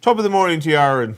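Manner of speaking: put-on cheery high Irish accent; loud stage Irish accent. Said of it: "it's an Irish accent".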